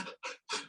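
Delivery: quick shallow in-breath gasp; sharp stress gasp. Shown as a demonstration. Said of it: "you go".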